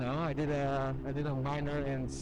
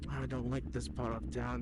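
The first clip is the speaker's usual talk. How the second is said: usual speaking voice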